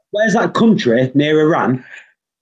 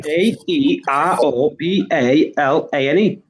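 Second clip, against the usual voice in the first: spelling it out. Shown as a demonstration. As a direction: spell it out deliberately, one letter at a time. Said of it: "we spell it with an O".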